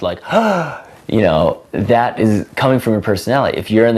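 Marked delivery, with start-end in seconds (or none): 0.3-0.8 a sharp high gasp; fake surprised gasp. Shown as a demonstration. Showing a fake surprised habit, a high gasp. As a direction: gasp in mock surprise, pitch jumping up. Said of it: "fake surprised".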